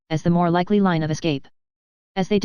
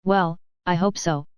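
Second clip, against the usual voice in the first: ordinary delivery, nothing marked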